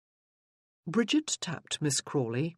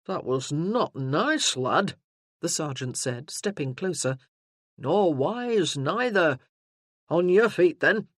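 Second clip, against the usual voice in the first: louder, parade-ground bark; a burly sergeant taking charge; booming soldier arriving in force; gruff sergeant; 5 dB louder than usual. Acted. Sergeant warns Crawley.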